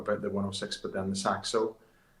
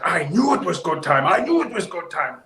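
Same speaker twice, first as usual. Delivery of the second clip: put-on high, loud Finnish-accented boast; pleased Finnish driver; 9 semitones higher, 9 dB louder than usual. Driver boasting about the time, high and loud in a Finnish accent. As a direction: gloat in a thick Finnish accent.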